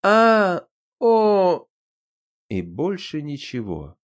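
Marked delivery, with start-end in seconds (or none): 0.0-1.7 loud high startled cry; a sudden shocked yelp. A narrator's reading. Guards cut down.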